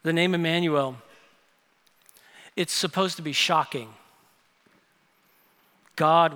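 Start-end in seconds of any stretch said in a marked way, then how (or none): none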